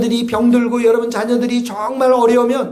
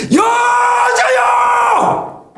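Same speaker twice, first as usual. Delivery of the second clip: drawn-out shouted call; 12 semitones higher, 4 dB louder, much slower than usual. Shown as a demonstration. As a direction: call 여자여 out high, loud and very slow. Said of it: "이, 이, 이런 소리예요". (높고 길게 외치는 "여자여!")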